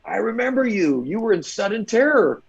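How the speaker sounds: high, delighted I remember you; light up at meeting a familiar stuntman; excited recognition. Retold or imitated. Stunt veteran greeting James in a bagel shop, high and delighted. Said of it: "And he goes".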